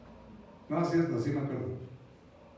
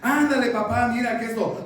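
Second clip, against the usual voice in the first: put-on raised, pleading kid voice; insistent child; 4 semitones higher, 6 dB louder than usual. Child wearing dad down, insistent. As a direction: keep nagging dad loudly.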